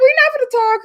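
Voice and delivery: high, loud mimicking refusal; mock dismissive. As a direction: squeal it out mocking her. Voicing Summer's refusal.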